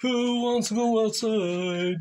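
drawn-out, inviting pet call; drawn-out coaxing call. Real, not acted. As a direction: coax a dog with a stretched question. Calling to the dog.

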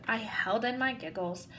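ordinary delivery

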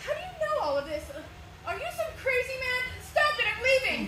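high, rising alarm at a stranger; frightened. Acted. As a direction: back away in panic, voice climbing.

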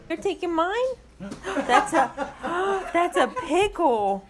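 high, slow amused surprise; caught-off-guard pickle gasp; surprised. Real, not acted. Toddler grabs pickle from dish.

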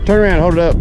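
ordinary talk

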